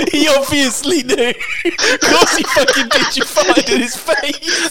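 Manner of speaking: high laughing voice; cracking up at the words; laughing incredulously. Real, not acted. Laughing at the story.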